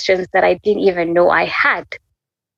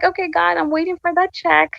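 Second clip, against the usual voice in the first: teasing prayer; 10 semitones higher than usual. Retold or imitated. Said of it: "I'd be like".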